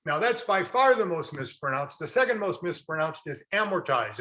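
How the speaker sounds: ordinary talk, nothing marked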